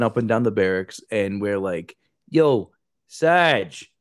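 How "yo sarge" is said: louder yo sarge; loud call. Retold or imitated. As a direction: call down the hall. Drunk, calling down barracks.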